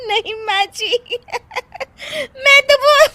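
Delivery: high excuse dissolving into laughter; helpless laughing. Acted. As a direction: start to explain, collapse into giggles. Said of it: "हंसने वाली दवा खा लेती है". (सफ़ाई देते-देते हंसी छूटना)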